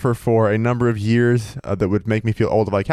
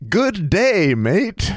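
higher, hammed-up mock Australian accent; 7 semitones higher, much slower than usual. Teasing a cohost with a cartoon Australian accent, hammed-up. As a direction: toss off a cartoon Australian greeting. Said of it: "what I thought was an Australian accent".

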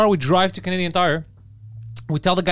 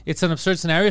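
ordinary talk

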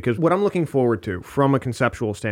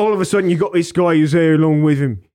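loud put-on Cockney; uneducated British accent; 3 semitones higher, 4 dB louder, faster than usual. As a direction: rattle it off in broad mock Cockney.

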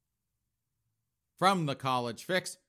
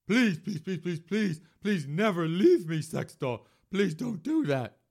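whiny begging; 6 semitones higher, 5 dB louder than usual. Acted.

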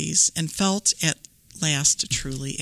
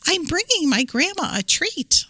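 put-on little girl voice; 11 semitones higher than usual. Red speaks to the wolf.

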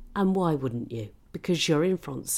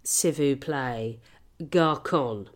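mock French complaint; 8 dB louder, much slower than usual. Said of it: "and the wine was cool".

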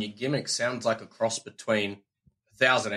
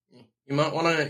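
ordinary talk

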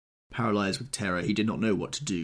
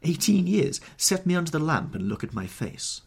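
raised, high, urgent plea to be seen; urgent outcry; 11 semitones higher, 5 dB louder than usual. Acted. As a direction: cry it out, higher and louder. Dorian confronting his attacker.

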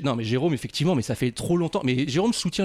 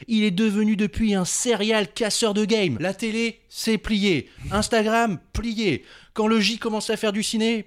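upbeat presenter read; show host intro; 7 semitones higher than usual. Acted. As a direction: hype up the guest like a host.

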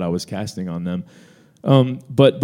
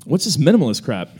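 higher, louder mom voice, sarcastic; a lightly voiced mom scoffing, higher and louder; mock indignant mom; 8 semitones higher, 3 dB louder than usual. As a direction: play the mom scoffing at the website. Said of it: "She's like".

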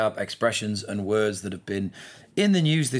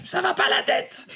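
put-on much higher shrill-free parent telling off a kid, "va" falling hard; scolding parent. Ben imitating parents yelling at kids in the park, scolding. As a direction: scold a naughty child across the park. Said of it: "I hear parents saying that".

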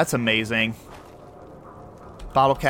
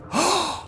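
a sudden high gasp of surprise; sharp gasp. Real, not acted. Gamer stumbling on a strange grave, gasping.

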